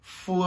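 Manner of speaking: "Fou" called high; quiz answer letter. Shown as a demonstration. Teacher revealing the quiz answer, high. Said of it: "le deuxième mot".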